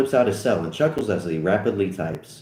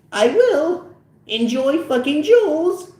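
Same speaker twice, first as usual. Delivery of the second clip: put-on louder, very high falsetto old lady; 4 dB louder, slower than usual. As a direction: call back as a hammed-up old lady. Old lady calls back.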